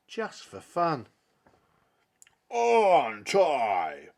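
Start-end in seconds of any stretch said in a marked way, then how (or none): 2.5-4.1 slow, drawn-out giant voice, much louder; boastful giant. Acted. Fossifu boasting with nobody watching, slow booming voice. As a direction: drag it out like a proud monster.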